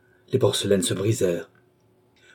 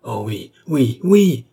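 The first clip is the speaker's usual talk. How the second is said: cried out; 3 semitones higher, 6 dB louder, slower than usual. A narrator's reading. Philippe vows to kill Gilbert, crying out. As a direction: explode on the last yes. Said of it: "s'écria le jeune homme".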